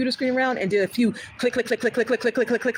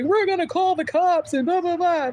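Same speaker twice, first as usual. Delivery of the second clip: mock threat; 8 semitones higher than usual. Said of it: "they would have a reason to say".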